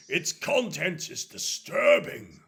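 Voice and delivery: loud, slow outrage from a priest; stern condemnation. Acted. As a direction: boom each word with grave disapproval. Father Coleman condemns the letter.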